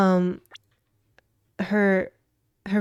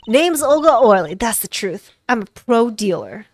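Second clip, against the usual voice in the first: brash reveal of a poker dealer; dropped-accent dealer voice; 9 dB louder than usual. Acted. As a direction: announce yourself boldly as a card dealer. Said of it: "I can drop the accent".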